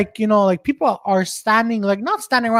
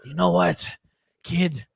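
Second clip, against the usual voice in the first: low, quiet tough-guy impression with long pauses; John Burtnell; 5 semitones lower, 7 dB quieter than usual. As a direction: drop it down, gruffly fond, word by word. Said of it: "towards the end, he goes".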